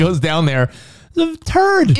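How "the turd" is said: high, squeaking shock at the find; shocked discovery. Retold or imitated. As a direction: squeal it out like a horrified clerk. Acting out the clerk finding it.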